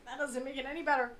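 ordinary talk, nothing marked

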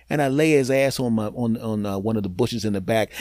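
ordinary delivery

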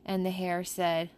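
ordinary delivery

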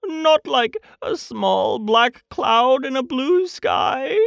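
high, slow, plaintive bear; anxious. A narrator's reading. Pooh hopes he looks like a cloud, anxious and plaintive. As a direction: plead gently, drawing the words out. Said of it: "said Pooh anxiously".

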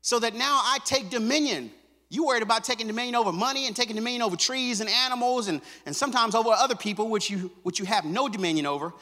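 high, loud preacher's cry; fiery pulpit delivery; preaching at full force. Acted. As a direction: preach it hard to the congregation.

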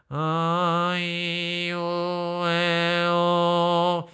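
high, slow, nasal sung vowels. Shown as a demonstration. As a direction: sing the vowels through the nose. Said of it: "if I did have nasality".

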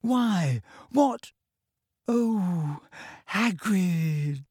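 high, dismayed, close to crying; near tears. A narrator's reading. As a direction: start high, sink into a dismayed sigh of words. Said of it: "sounded as though she wanted to cry".

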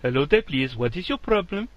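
badly faked Indian accent. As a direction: put on a clumsy Indian tech-support accent. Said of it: "I can't do Indian either".

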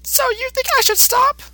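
put-on very high childlike monster voice; Boo voice. Boo answering Mister Satan simply, in a very high childlike voice. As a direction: answer like an innocent little creature.

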